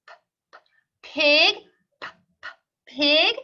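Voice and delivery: usual speaking voice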